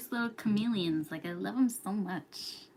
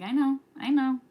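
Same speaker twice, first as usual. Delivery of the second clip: louder, higher "yeah, got it"; acted reassurance; 5 semitones higher, 7 dB louder than usual. Streamer replaying her answer to a jealous friend, placating.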